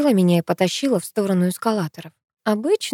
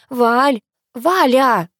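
louder, rising call of a name; 4 semitones higher, 5 dB louder, much slower than usual. Acted. Girl calling her friend down a dead phone line, calling out louder.

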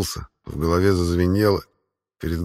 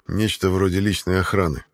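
ordinary delivery, nothing marked

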